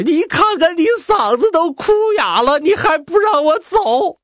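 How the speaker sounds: very high, strained weepy complaint; mock tearful whine. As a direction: whimper it through fake tears. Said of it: "皇上眼泪又下来".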